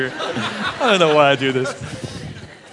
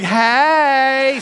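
coy hey; 9 semitones higher, 4 dB louder than usual. Pretend. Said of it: "Leah's sitting there like".

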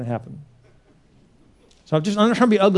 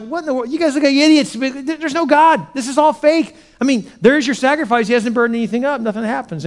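high-pitched incredulous mockery; taunting; 9 semitones higher, 3 dB louder than usual. Elijah mocks the Baal worshipers' failed sacrifice, taunting. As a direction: ridicule them, voice pushed way up. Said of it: "he's taunting him".

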